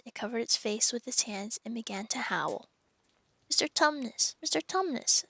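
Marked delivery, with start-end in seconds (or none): 3.5-5.2 put-on higher, anxious girl calling his name; a touch of distress in a child's raised voice; distressed plea. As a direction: plead with him, worried and rising. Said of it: "said Lucy in great distress".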